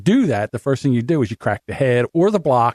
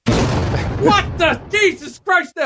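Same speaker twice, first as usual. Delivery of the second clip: high mock shout of disbelief; dad's exasperated shout; much slower than usual. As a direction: throw up your hands as dad. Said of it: "He's like".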